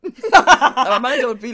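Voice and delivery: loud laughter through the words, slow; can't stop laughing while telling it. Real, not acted. Laughing while retelling awkward meeting.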